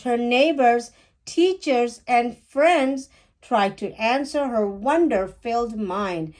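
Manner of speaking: plain reading voice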